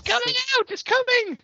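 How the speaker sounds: squealing very high, giddy fan; hammed-up fanboy squeal; mock excitement. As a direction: shriek it like an overjoyed superfan. Mocking a fan's glee.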